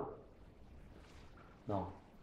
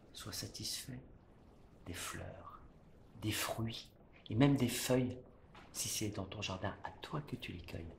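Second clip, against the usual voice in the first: softer, lower, inward stage voice; hushed declamation; 3 semitones lower, 8 dB quieter than usual. Acted. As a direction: bring it down to a proud hush. Proud, inward close of tirade.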